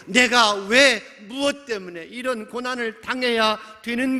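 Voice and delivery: high-pitched protest to God; anguished complaint. Acted. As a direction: cry out why, loud at first, then easing in volume. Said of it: "점점 더 불평하기 시작하고". (높은 목소리로 따지듯 원망하며)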